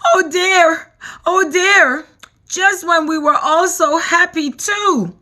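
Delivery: high, tearful wail; sobbing ladybug lament. A narrator's reading. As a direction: cry it out in despair. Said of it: "cried the ladybug".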